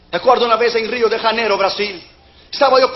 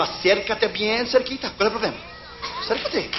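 soft coaxing; 9 dB quieter than usual. Retold or imitated. Coaxing beggar closer, softly.

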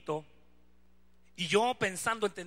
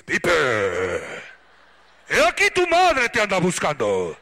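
booming, slow mock-biblical proclamation; mamá solemne fingida; 3 semitones lower, 13 dB louder than usual. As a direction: bellow it slowly and grandly, absurdly formal. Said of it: "¿A, a poco te dice". (voz atronadora y solemne de madre, en burla)

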